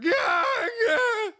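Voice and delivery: high strained cry-yell; dad groaning from chair. Retold or imitated. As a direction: mimic dad hauling himself up in pain. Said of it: "my dad getting out of the chair going".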